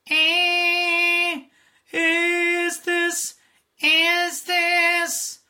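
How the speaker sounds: high, loud bratty sung line; bratty or witchy voice. Shown as a demonstration. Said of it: "a bratty voice or a witchy voice".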